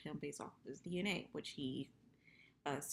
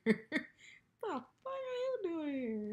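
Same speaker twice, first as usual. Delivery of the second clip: high, loud, slow mock yell; mock outraged yell; 12 semitones higher, 5 dB louder, much slower than usual. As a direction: stretch it out in hammed-up outrage. Retelling the same angry line.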